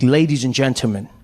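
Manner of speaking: low, composed address; cool, calm showman. Acted. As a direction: address the crowd calmly and in control. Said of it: "he was really cool and calm".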